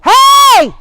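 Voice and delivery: real high, very loud scream; sudden full-force yell at the dogs. Real, not acted. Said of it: "sorry for screaming".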